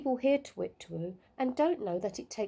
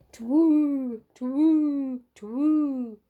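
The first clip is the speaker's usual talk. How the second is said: loud owl hoots; owl hooting; 5 semitones higher, 8 dB louder, much slower than usual. A narrator's reading. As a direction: hoot it like an owl answering. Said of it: "hooted Albert".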